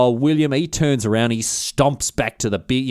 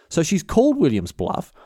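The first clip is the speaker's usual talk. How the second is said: ordinary delivery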